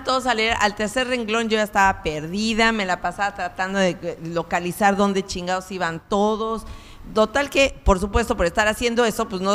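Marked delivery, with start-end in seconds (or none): none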